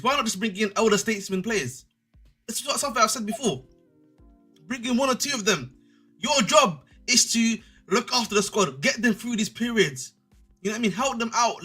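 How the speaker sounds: ordinary talk, nothing marked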